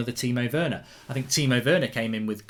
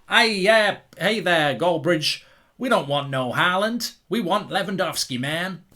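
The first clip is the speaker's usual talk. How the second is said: lightly put-on American accent, a bit higher; mock American fan; 5 semitones higher, 4 dB louder, slower than usual. Vlogger imitating an American Chelsea fan, mock American accent. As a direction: do a loose American drawl-free fan voice. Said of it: "whether that sounded like it was Yorkshire or America".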